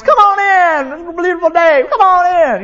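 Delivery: sugary high welcome voice; suddenly all sweetness for the guest at the door; fake sweet greeting. Greets doorbell guest after yelling.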